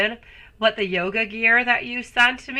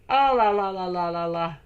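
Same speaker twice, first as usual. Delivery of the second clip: playful oh la la. Acted. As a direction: tease them with a lilting oh la la that fades. Playful exclamation to viewer.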